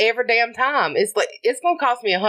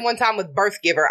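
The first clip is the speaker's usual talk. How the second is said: ordinary talk, nothing marked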